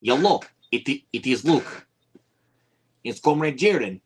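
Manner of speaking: high, put-on Russian accent; jokey fake Russian comrade voice. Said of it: "I can speak in a Russian accent".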